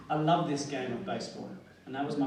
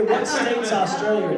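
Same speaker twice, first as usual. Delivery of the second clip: Texan confusion; 6 semitones higher, 8 dB louder, slower than usual. Retold or imitated. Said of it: "Texan asks which state".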